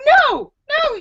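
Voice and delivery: high-pitched indignant refusal; retold firm refusal. Retelling refusing the intruder.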